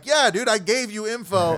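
retelling his exasperation; exasperated. Retold or imitated. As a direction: throw up your hands at the guest. Retold exasperated reply.

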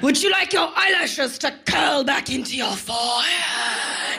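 chastise like a posh British ad; English accent ad voice. Retold or imitated. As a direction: overblown English accent pitch. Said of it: "English accent chastises you".

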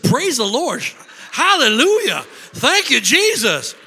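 loud, high, rising praise; husband praising in the speeding car, jubilant; joyful praise. Retold or imitated. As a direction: burst out praising.

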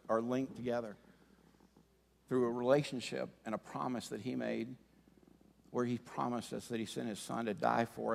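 soft, low, intimate sermon voice; hushed heartfelt preaching; quiet preaching. Acted. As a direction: draw the room in gently. Soft reflective sermon close.

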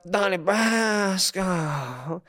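drawn-out mock Italian mobster accent; terrible Italian accent. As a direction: stretch the name in fake Italian. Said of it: "in an Italian accent".

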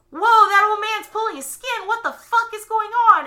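high, loud mock horror; freaked-out viewer. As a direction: yelp it up high and loud, horrified. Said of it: "you're like".

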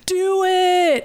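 high, drawn-out yell at no extra volume; mock scream. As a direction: imitate someone hollering from another room. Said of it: "He's just screaming".

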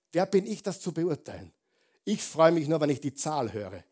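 normal talking voice

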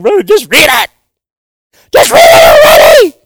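loud exasperated yell at the reader; impatient shout. Retold or imitated. Listener urging the preacher to hurry, loud impatient shout. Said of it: "When you read".

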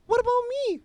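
high whiny imitation; shrill falsetto protest in mockery; mock whiny woman. Mocking the woman who got cut in line, high whine. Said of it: "she legitimately says".

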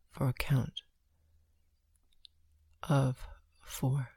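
slow, hushed breath counting. Acted.

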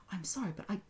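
hushed, awkward apology; embarrassed whisper. A narrator's reading. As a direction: whisper it politely. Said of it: "she had to say".

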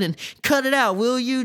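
high, exasperated order to stop; acted irritation; fed-up interruption. A narrator's reading. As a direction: break up a squabble, pitched well up. Drew breaks up the fight.

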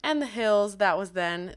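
usual speaking voice

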